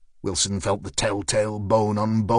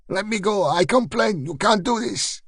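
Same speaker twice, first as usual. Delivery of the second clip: put-on higher, panicked spluttering in an Eastern European accent; higher, frantic spluttering shopkeeper; 10 semitones higher than usual. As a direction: splutter in protest while being lifted off the ground. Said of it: "spluttered the man".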